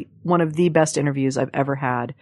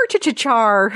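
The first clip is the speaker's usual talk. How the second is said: a hammed-up, pirate-like Irish stab, a little higher; mock Irish "cha, cha, char", deliberately bad; bad Irish accent; 4 semitones higher, slower than usual. Shown as a demonstration. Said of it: "My Irish accent is char".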